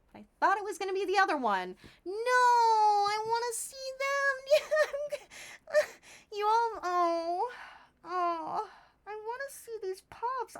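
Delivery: fighting back tears; tearful. Real, not acted. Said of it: "I'm sorry to get emotional".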